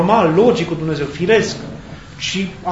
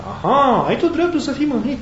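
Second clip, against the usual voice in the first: high, lilting "aha" of feigned agreement; lightly acted, high-pitched questioning comeback; knowing echo; 9 semitones higher than usual. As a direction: echo the complaint back in a raised pitch. Acted dialogue, God echoes Jonah's complaint.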